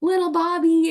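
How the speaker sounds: high, loud fond grandpa voice. Retold or imitated. Man fondly naming his grandkid, high and loud. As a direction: say the name like a proud grandfather. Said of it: "he's like".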